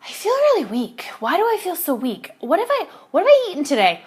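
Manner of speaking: high, whiny puzzled voice; feeling weak. Retold or imitated. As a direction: play it up as feeble and baffled. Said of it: "and I was like".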